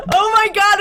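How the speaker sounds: high, loud shocked outcry; shocked cry. Real, not acted. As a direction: gasp it out in disbelief. Shocked after teacher insult.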